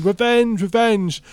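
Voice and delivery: high-pitched mock shout; retold yell, pitched well up; imitated shouting. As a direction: cry it out high, imitating a gleeful friend. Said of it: "his friend's just shouting".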